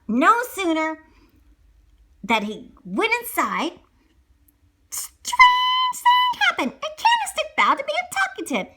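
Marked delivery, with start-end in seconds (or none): 4.9-6.7 high, slow, drawn-out wonder; suspenseful narration. A narrator's reading. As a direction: stretch it out like a big reveal.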